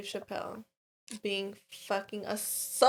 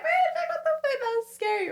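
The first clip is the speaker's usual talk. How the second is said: much higher, strained scared exclamation; retold fright. Telling friend about hearing breathing, high scared voice. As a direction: relive the panic under the blanket. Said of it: "And I was like".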